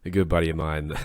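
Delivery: ordinary talk, nothing marked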